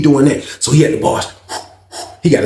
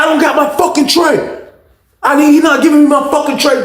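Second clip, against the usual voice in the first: angry demand; 13 semitones higher than usual. Retold or imitated. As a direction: keep pushing for the tray, fed up. Inmate demanding his tray.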